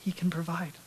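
whispered, intimate assurance. Real, not acted. Pastor reassuring the congregation in a whisper. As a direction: breathe it like a secret comfort.

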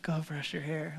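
hushed parent voice; muttered parental order. Acted. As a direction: drop to a quick, quiet order to a child. Parent telling child to fix hair.

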